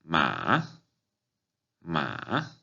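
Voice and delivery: "ma" dipping low, then rising; third tone. Shown as a demonstration. Teacher demonstrating third Chinese tone, "ma" dipping then rising. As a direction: draw out "ma" down and back up. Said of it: "abajo y arriba".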